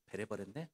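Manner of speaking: 베레버렸네 said in Jeolla dialect; 베레버렸네 in a light Jeolla dialect; Jeolla dialect word. Shown as a demonstration. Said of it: "전라도 사투리로 말하면".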